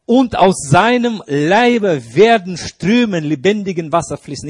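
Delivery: proclaiming scripture. Acted. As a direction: declaim it like a preacher reading scripture aloud. Preacher proclaiming scripture verse.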